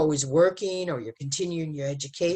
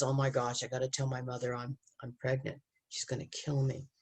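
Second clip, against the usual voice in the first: put-on hushed, softer panicky inner voice of a scared teen; a teen dreading telling her mother, hushed fear; scared girl; 4 semitones lower, 9 dB quieter than usual. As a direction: murmur it like a frightened girl afraid to confess. Scared pregnant girl's inner panic.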